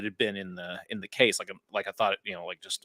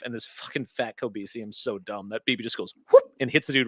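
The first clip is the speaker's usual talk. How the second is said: ordinary talk, nothing marked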